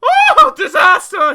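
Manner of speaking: drawn-out high scream of horror. A narrator's reading. Mister Jelly seeing the tramp's face, screaming. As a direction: scream it in utter panic. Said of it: "he screamed".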